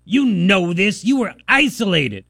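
mock a nasty old codger with a sneer. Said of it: "The nastiness in that old codger's voice".

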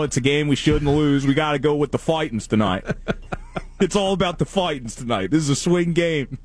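high, laughing Philly fan voice; cheering Phillies fan accent. Pretend. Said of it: "it is a hilarious accent".

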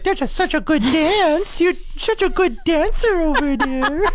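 very high, sing-song praise of the dog; dad's dog voice. Retold or imitated. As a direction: squeal praise at the dancing dog. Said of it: "Your dad would be like".

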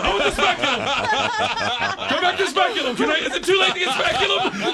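high, loud, half-laughing begging; panicked pleading. Retold or imitated. Man begging girl to switch tools, mock panicked plea. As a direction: beg frantically, hammed up for laughs.

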